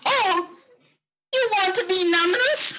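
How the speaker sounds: high, eager question; high-pitched, quick query; hopeful misunderstanding. Acted. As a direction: lift it high as a hopeful guess. Collector hopefully misreads the refusal.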